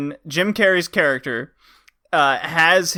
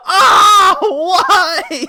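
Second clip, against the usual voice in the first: high, loud comic wail; mock pained scream; 12 semitones higher, 7 dB louder than usual. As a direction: wail in hammed-up agony, laughing. Imitating speared character's scream.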